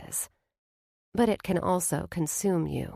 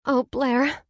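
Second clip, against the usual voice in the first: tearful apology; 7 semitones higher, 4 dB louder than usual. A narrator's reading. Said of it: "I hiccup out an unexpected sob".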